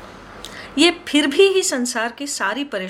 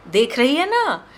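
higher, louder pushing repeat; louder insistent repeat; pressing insistence; 4 semitones higher, 4 dB louder than usual. Acted. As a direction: push her to agree. Showing off saris to vendor.

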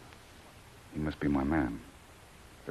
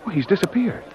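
higher and louder, amazed discovery; astonished; 8 semitones higher, 5 dB louder than usual. Acted. Jungle Jim watching Pedro vanish into the rock, astonished and higher. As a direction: react like the man just vanished.

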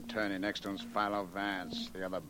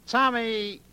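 Tommy called out much higher and louder, falling at the end; 12 semitones higher, 7 dB louder than usual. Acted. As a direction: shout Tommy to snap him to attention. Calling the henchman again, louder.

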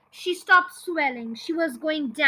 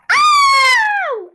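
long, piercing high yowl; yelled yowl; 13 dB louder than usual. A narrator's reading. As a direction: let out a very loud, held shriek of pain. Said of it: "Grandma yelled".

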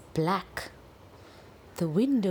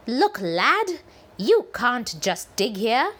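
stern Gran; 5 semitones higher, 6 dB louder than usual. Acted. Gran scolds pirate.

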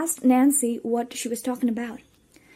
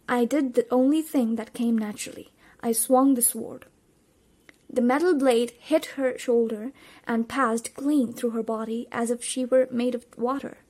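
usual speaking voice

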